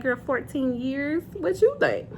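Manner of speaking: teasing know-it-all friend; incredulous scoff. Retold or imitated. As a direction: play it like "girl, obviously". Friend voiced mocking disbelief.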